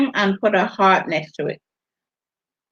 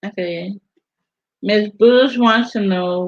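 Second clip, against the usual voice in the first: usual speaking voice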